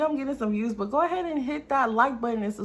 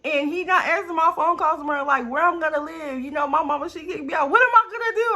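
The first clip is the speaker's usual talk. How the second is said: high, louder, rising mock panic; retelling her panic when he would not answer; retold panic; 8 semitones higher, 5 dB louder than usual. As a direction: replay the frantic worry.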